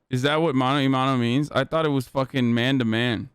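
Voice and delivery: ordinary talk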